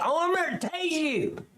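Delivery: high-pitched mock threat; mocking imitation. Reactor mimics an officer's taser threat, mocking. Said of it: "Did you hear this fucking idiot?".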